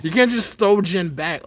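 slow, drawn-out disbelieving telling-off; incredulous, emphatic mock reproach; exasperated scolding. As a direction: retell scolding a friend, stretching the words. Retold scolding of friend at party.

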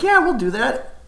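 high-pitched mock kid voice; twelve-year-old impression. Kid agreeing to join the fort, twelve-year-old impression. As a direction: pipe up like a keen kid. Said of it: "still trying to do this twelve-year-old impression".

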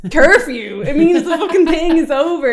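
laughing while explaining. Retold or imitated. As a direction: laugh through the words. Friends laughing at him.

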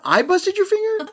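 high-pitched squeal of disbelief; high, indignant disbelief; incredulous. Real, not acted. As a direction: protest the accusation, voice shooting way up. Mock disbelief at being blamed.

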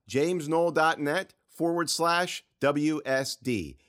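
slow, careful URL; spelled-out web address. Shown as a demonstration. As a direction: say each part of james noel dot net slowly. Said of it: "So that's J A M E S".

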